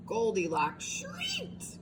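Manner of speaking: slow, breathy whisper; hushed whisper. A narrator's reading. Reading to children, the scary moment in a whisper. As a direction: whisper it for suspense.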